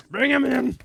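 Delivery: high, loud barked order; shouted orders to the guards; commanding shout. Acted. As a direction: call the guard loudly. Ordering in a suspect.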